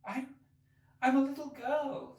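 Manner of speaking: doubtful. Acted. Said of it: "rather doubtfully".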